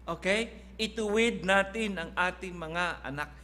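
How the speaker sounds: ordinary talk, nothing marked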